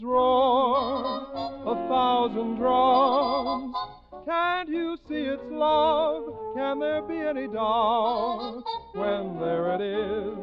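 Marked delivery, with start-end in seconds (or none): none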